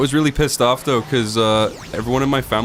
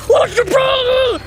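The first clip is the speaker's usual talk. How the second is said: very high, drawn-out mock wail; imitated upset girl; 4 dB louder, slower than usual. Girl answering his apology, imitated high wail. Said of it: "And she was like".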